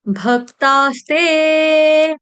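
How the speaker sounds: भक्तास्ते drawn out slowly, half स then ते; slow careful syllables. Shown as a demonstration. Teacher showing devotees भक्तास्ते slowly. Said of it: "स्ते आधा स और ते".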